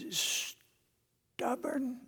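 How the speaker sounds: breathy whisper of the word Stubborn. Shown as a demonstration. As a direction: hiss Stubborn under your breath, high. Whispered repeat of nickname.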